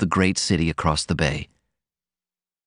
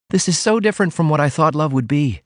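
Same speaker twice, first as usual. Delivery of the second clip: higher-pitched heroine announcing out of the blue; wondering heroine; 7 semitones higher, faster than usual. A narrator's reading. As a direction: marvel aloud in a lifted, feminine voice. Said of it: "she announced irrelevantly".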